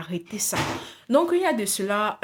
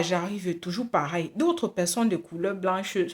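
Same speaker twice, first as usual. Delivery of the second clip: ordinary talk, nothing marked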